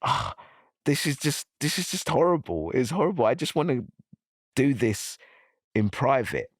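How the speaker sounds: breaking down, begging for privacy; distressed, near tears. Retold or imitated. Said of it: "me crying and swearing and breaking down".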